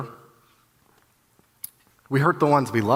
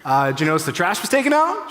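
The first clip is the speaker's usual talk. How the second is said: eager follow-up; 7 semitones higher, 6 dB louder than usual. Retold or imitated. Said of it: "She goes, "Yeah".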